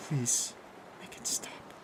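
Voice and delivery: soft, breaking, whispery plea; cracking whine. A narrator's reading. Kathy begging in the dark, whining brokenly. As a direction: beg in a small cracking voice. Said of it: "whined in an awful cracking voice".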